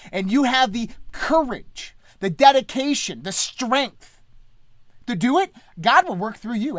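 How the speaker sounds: rally them like a preacher; rousing preaching. Acted.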